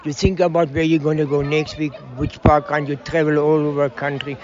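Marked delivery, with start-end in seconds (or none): none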